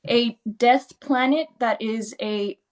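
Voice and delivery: usual speaking voice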